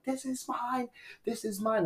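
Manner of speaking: high but soft mock yell; retold yelling. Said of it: "the guy's yelling".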